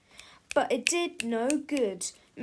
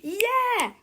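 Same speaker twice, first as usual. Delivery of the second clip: put-on high, loud kid's cry; 11 semitones higher, 5 dB louder than usual. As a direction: call out in agreement. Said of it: "cried Harold".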